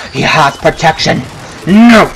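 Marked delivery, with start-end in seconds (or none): 1.7-2.1 loud sharp cry of dismay; alarmed shout. Real, not acted. Gamer shouting as enemy gets protection. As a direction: blurt it out as things go wrong. Sudden in-game setback.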